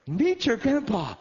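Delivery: high, loud, slow; proud announcement. Retold or imitated. Grandmother presenting her grandson, high and loud. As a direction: present him like a prize. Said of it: "she said".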